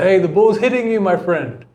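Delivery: retold shout from a distance; imitated shout. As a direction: call it out like a coach across the court. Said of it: "he just shouts from across the way".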